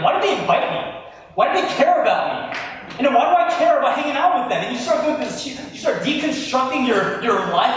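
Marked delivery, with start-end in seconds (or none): 0.0-4.6 high, loud, resentful questioning; mock-bitter ranting at friends. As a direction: spit out the grievance with rising heat. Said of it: "where you're like".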